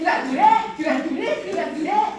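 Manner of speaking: high, loud insistent list; retold badgering, pressing point after point; on her case. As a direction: imitate someone nagging, punching each demand. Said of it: "that's why she was on me".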